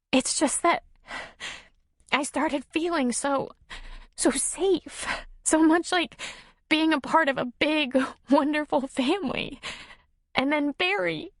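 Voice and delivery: high, halting tearful woman's voice; tearful hiccup. A narrator's reading. Nora confiding why she is crying, tearful and halting. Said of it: "with a hiccup in her voice".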